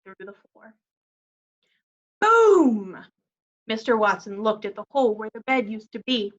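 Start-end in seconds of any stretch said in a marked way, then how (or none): 2.2-3.0 boom sound effect. Acted. Bed crashes through floor.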